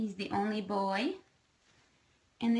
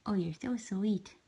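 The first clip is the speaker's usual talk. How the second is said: ordinary talk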